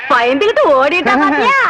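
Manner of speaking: low-pitched gloat breaking into a cackle; triumphant laughing jeer; gloating laughter. Acted. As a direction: crow over it, then laugh. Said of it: "நீ சிரிக்காத ஆத்தா".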